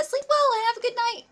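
high, loud goodnight; high sing-song. Real, not acted. Verabschiedet Zuschauer.

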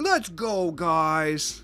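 hammed-up hype-channel greeting; YouTuber voice. Shown as a demonstration. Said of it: "Let me do YouTuber voice".

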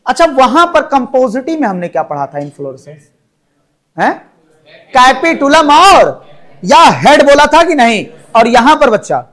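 usual speaking voice

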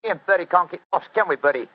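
loud, high Cockney workman; brash Cockney council man at the door; Cockney accent. Acted. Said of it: "My Cockney accent".

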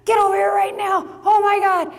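high, loud urgent call; urgent shout. Retold or imitated. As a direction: yell it like an emergency on the phone. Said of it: "He said".

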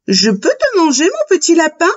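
wolf's question. A narrator's reading. Wolf asking the little rabbit, lightly acted high question. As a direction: ask it high, rising at the end.

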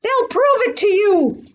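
high, urgent pleading; panicked protest after a gasp; gasping alarm. A narrator's reading. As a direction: plead desperately, pitch way up. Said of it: "gasped Horton".

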